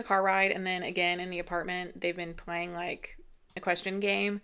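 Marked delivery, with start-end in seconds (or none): none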